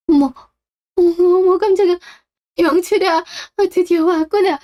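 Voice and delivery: high, startled exclamations; surprised gasp. Acted. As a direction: caught off guard by a sudden visitor. Mother sees son arrive suddenly. (깜짝 놀란 높은 목소리)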